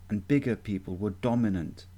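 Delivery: ordinary talk, nothing marked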